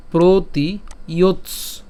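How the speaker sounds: "প্রতি ইয়োৎস" slow, ending on a clipped half t; careful pronunciation. Shown as a demonstration. Teacher showing the half t sound slowly. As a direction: break "ইয়োৎস" off on the dental t with no vowel. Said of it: "ইয়োৎস অর্থাৎ দন্তাস্য হসন্ত পর্যন্ত উচ্চারণ করছি".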